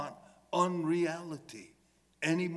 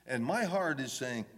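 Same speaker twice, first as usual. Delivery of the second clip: normal talking voice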